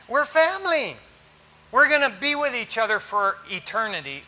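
high, loud, ringing We're family; emphatic we're family. Acted. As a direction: proclaim it to the church. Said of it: "I keep telling you guys".

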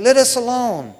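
acted outcry of a demon; crying out. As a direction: cry out a loud plea to be left alone, as in a Bible reading. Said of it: "he cried out".

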